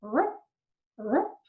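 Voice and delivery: quick rising "rup", pitch shooting up at the end; short upward whoop; imitated gravitational-wave chirp. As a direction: mimic the upward chirp as a greeting. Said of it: "This characteristic sound of".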